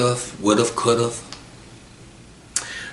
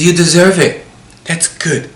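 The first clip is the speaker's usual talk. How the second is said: high, emphatic happy friend voice; retold approval; 8 semitones higher, 4 dB louder than usual. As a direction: cheer a friend on, pitched up. Voicing friend's delight on phone.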